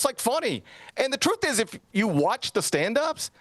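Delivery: ordinary talk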